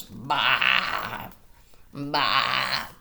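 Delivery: low bleating sheep sound. Acted. Reading to children, a low sheep bleat. Reading farm animal noises.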